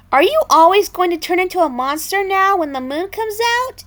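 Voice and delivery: high, fast, worried asking; concerned question. A narrator's reading. Brothers asking Matilda in concern. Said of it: "asked in concern".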